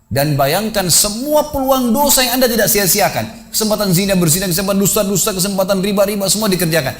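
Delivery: high, drawn-out exhortation to a congregation; fervent preaching. Retold or imitated. (khotbah menggugah dengan nada tinggi)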